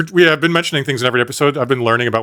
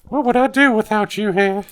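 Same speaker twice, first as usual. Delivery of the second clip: Southern belle voice; 10 semitones higher than usual. Pretend. Playing damsel.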